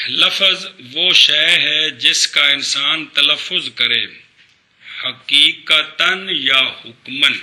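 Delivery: measured pace for students to follow; slow dictation. Real, not acted. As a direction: give the definition word by word, slowly. Teacher dictates a definition for repetition.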